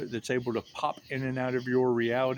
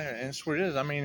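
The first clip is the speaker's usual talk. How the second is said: normal talking voice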